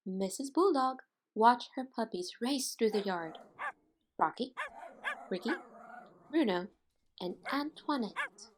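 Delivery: put-on lively read-aloud voice for children; story narration. A narrator's reading. Teacher reading a picture book to her class, lively storytelling.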